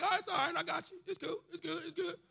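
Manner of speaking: high, light, easygoing reassurance; imitating a forgiving God, casually soothing; reassuring God. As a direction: wave it all away in quick little repeats. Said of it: "God's like".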